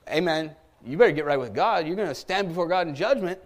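ordinary delivery

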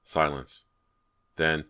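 plain reading voice

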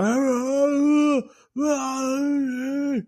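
high strained pained cry, imitated; mock dying wail, held high; frozen groan. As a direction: squeeze out a frozen man's agonized ah ah ah.

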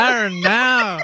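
loud, drawn-out Australian accent. Shown as a demonstration. Co-host showing off the accent trick, exaggerated Australian accent. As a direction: stretch it out slowly in broad Australian.

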